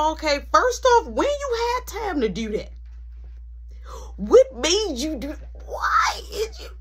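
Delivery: high outraged wailing protest; exasperated plea, half crying; exasperated protest to mom; outraged disbelief. Retold or imitated. Arguing with mom about alarms.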